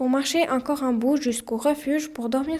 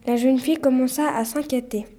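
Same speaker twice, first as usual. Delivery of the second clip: ordinary delivery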